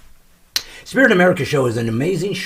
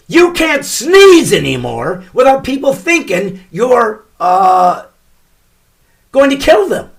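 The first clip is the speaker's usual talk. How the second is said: much higher and louder comic rant, slowed on the punch; ranting shout; 8 semitones higher, 9 dB louder than usual. Acted. Comedian rants loudly to listeners about sneezing in public. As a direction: build the outrage like a stand-up bit.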